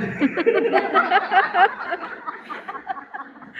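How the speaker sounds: loud high burst of laughter; big laugh. Real, not acted. Laughs at own story.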